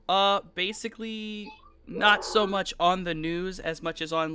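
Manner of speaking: usual speaking voice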